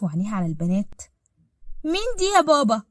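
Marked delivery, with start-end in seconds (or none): 1.8-2.8 put-on high-pitched little kid question; curious child. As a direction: ask Daddy like a curious child. Kind fragt nach der Fremden.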